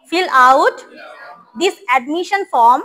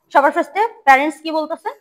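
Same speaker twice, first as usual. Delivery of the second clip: ordinary talk, nothing marked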